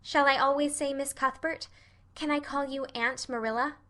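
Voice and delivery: put-on high girlish questioning; curious Anne. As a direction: ask eagerly as a child.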